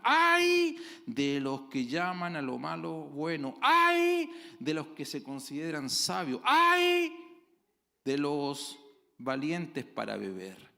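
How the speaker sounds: thundering preacher's lament; proclaimed prophetic woe. Acted. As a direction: cry out the prophet's lament to the congregation. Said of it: "Isaías dice".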